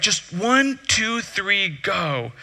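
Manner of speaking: soft, trailing-off count; chill countdown. Retold or imitated. Slackliner counts the nervous student onto the line, chill countdown. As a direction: imitate an unbothered coach counting in. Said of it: "how to be chill like you".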